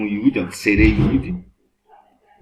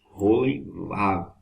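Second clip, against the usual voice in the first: Rolenvav stretched very slowly; slow repeat; much slower than usual. Shown as a demonstration. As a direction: say Rolenvav syllable by syllable. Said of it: "que ficou Rolenvav". (Rolenvav repetido bem devagar)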